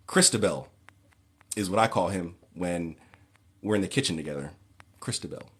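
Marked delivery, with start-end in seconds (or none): 5.0-5.5 breathy whispered pet name. Pretend. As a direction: whisper it intimately.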